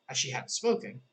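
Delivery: usual speaking voice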